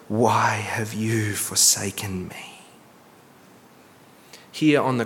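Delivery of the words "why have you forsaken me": whispered anguish. Acted. Jesus' cry, quoted from scripture.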